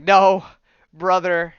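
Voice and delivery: dramatic outburst of refusal; acted snapping protest. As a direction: cry out in mock anguish. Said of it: "Scarlet Witch snaps".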